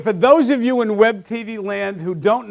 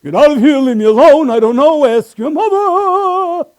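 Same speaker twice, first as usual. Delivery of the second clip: loud, high barking German officer; loud, high mock German officer; Colonel Klink; 8 semitones higher, 10 dB louder than usual. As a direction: bark it like Klink dismissing the kid. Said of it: "turn into Colonel Klink and Sergeant Schultz".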